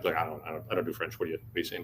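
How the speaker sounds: low, soft retold stammer; halting and puzzled, kept down low; baffled reply. Tim answering his VP swearing in French, baffled.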